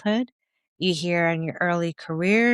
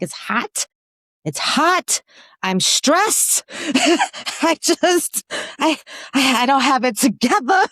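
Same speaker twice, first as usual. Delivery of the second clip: flustered; 8 semitones higher, 4 dB louder, slower than usual. Retold or imitated. Acting premenopause hot flash panic.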